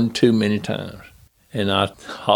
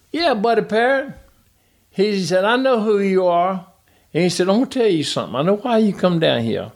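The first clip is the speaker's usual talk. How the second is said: lightly voiced tough driver, higher; a hint of a cocky racer sizing you up; imitating Tommy; 9 semitones higher than usual. Driver sizing up the new crew chief, lightly voiced swagger. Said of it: "That's the way he talked".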